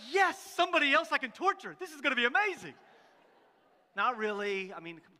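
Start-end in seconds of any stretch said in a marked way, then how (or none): 0.1-2.7 ecstatic. Retold or imitated. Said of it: "who's just ecstatic".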